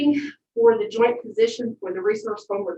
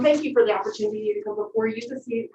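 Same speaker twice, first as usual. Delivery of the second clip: ordinary talk, nothing marked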